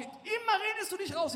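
high, loud retold scolding; upset outburst. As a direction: imitate an exasperated accusation at full volume. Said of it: "da hat sie sich aufge".

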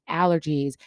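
normal talking voice

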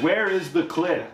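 high, slow dramatic question; searching heroine. Acted. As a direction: call it out drawn-out, like a quest. Voicing the searching heroine in Egypt.

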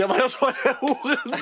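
high-pitched, laughing retold comeback; retold through laughter. Retelling the cheeky answer to the teacher, laughing through it. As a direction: quote yourself while cracking up.

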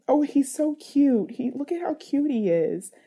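mock the sugary praise strangers give; cooing. Mother imitates people praising her son, mock cooing.

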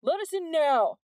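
loud, high demand; yelling man. A narrator's reading. A man in the mob yelling to be let in. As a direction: yell from the crowd. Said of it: "a man yelled".

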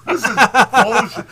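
high, loud, slow laughing disbelief; laughing exasperation. Retold or imitated. Retelling the movie to friends, loud laughing disbelief. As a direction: blurt it out through big laughter. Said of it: "I'm like".

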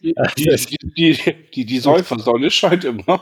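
words broken up by laughter; laughing. Real, not acted. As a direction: get it out while cracking up. Witz über Kölner Sonne.

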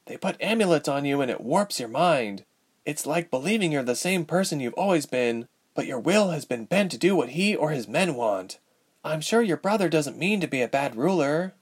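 lightly voiced, understanding young woman; sympathetic young heroine. A narrator's reading. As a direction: explain it from bitter experience. Narrator voices Ilse, sympathetic.

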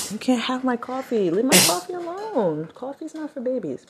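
high playful scolding; teasing pet scold. Real, not acted. Scolding the pet over coffee.

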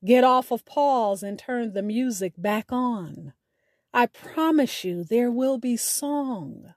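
usual speaking voice

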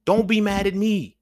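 rising exasperation, spelled out slowly; exasperated plea. Retold or imitated. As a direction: plead it, fed up with being blamed. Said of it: "It's like, "I didn't lie to you".

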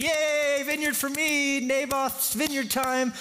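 very high, childish mock glee; so excited. King celebrates getting the vineyard, hammed-up excited. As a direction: squeal it, drawing out the last words slowly. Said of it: "Ahab's so excited".

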